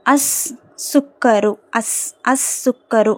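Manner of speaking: slow "அஸ் சுக்கரு", long double S in place of L; teacher breaking up "அஸ் சுக்கரு" slowly; lam silent. Shown as a demonstration. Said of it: "லாம் உச்சரிக்கப்படலை".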